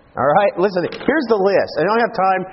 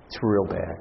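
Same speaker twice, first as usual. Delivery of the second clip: grave and hushed; 9 semitones lower, 9 dB quieter, slower than usual. Acted. Grave refrain, dropping away.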